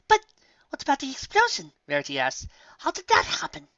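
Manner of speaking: high, refined teasing; playful lady; posh lady voice. A narrator's reading. Rarity voiced.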